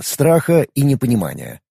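usual speaking voice

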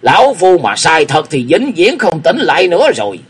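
put-on old master retorting to the young man's question, sharp; glaring retort. A narrator's reading. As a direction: snap back at him, eyes blazing. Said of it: "quát mắt lên đáp".

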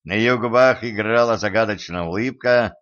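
ordinary delivery, nothing marked

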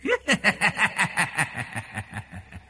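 ghostly falling cackle; possessed woman's eerie laugh. Acted. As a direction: laugh like a spirit mocking him. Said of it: "Tiếng cười lại càng to hơn".

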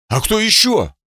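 high startled question; surprised. Acted. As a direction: blurt it out in surprise.

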